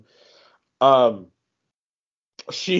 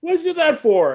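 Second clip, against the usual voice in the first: much higher, mock-indignant protest; indignant hero. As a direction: squeal it out like a lightly voiced offended hero. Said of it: "he's like".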